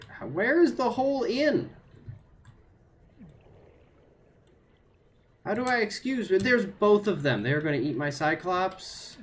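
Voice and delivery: high, loud panicked scramble; real fright as monsters close in; frantic panic. Real, not acted. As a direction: voice jumps way up in alarm. Creatures close in, frantic searching.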